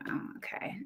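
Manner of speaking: barely-there whisper, unimpressed; whispered meh. Retold or imitated. Reacting to dull Super Bowl ads, whispered and unimpressed. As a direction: breathe it out with a shrug. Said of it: "I was just like".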